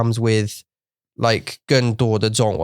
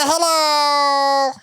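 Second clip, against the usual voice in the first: Hello much higher and sing-song; 13 semitones higher than usual. Retold or imitated. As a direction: chirp Hello up high. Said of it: "my voice changes like".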